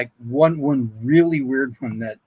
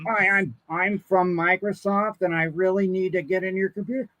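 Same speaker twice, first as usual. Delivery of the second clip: lightly voiced, higher-pitched scam caller; a hint of an eager phone scammer; 6 semitones higher than usual. Scam caller claiming to be from Microsoft, lightly acted higher voice.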